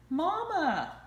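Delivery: high, loud duckling call for mama; crying out. A narrator's reading. Ducklings calling for their mama, loud and high. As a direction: call out for your lost mother. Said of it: "cried the ducklings".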